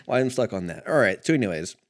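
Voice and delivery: normal talking voice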